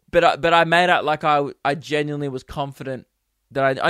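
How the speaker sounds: normal talking voice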